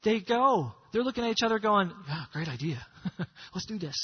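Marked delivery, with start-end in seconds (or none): 2.1-4.0 low, soft mock agreement with a chuckle; half-hearted agreement. Lepers looking at each other as they go, half-hearted agreement. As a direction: agree quietly, laughing a little.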